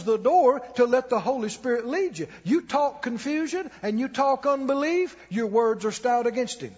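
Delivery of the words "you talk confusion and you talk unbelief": ordinary delivery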